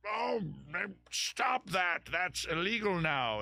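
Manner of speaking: high, whiny mock outrage at a harmless act; mocking scold. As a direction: imitate a prissy busybody wagging a finger. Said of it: "everybody goes".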